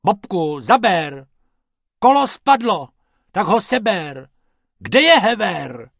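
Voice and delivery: loud, high bickering shout; squabbling. Acted. Bob squabbles with Bobek while fixing the jeep, loud and high. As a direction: bark orders at your partner, loud and high. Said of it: "trochu se hádali".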